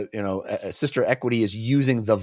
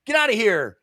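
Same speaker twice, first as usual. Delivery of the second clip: mock angry dismissal; 9 semitones higher, 3 dB louder than usual. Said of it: "she's like".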